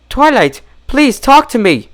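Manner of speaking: high, anxious plea; pleading Rarity. Acted. Rarity pleading with her strange friend. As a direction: plead gently but urgently. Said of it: "Rarity pleaded".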